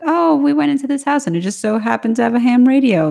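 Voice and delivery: high, dismissive mock narration. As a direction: scoff at the lazy plot device. Mocking writers' throwaway plot device.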